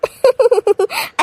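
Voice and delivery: teasing run of chuckles; mocking giggle. Bhabhi makes fun of sister-in-law's ignorance, mocking giggle. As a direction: laugh at her ignorance.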